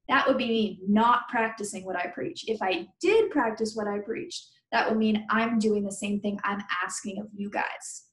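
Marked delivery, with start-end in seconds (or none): none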